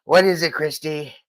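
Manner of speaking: lightly voiced president impression; Trump. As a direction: answer as a put-upon president. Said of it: "go to Trump and be like".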